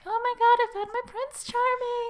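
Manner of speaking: very high-pitched mock girlish squeal; lovestruck girl. Cohost mocking a girl in secret love, lovestruck squeal. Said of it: "just a girl, like, being like".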